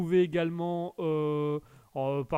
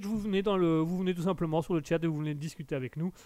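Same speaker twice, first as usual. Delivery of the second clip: ordinary delivery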